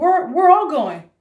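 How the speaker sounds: loud, high, drawn-out retold decree; rally the family, acting it out; urgent insistence.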